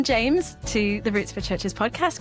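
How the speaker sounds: ordinary delivery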